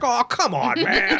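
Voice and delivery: high, laughing mock plea; whiny protest. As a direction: whine it like a scolded drunk. Retold drunk pleading.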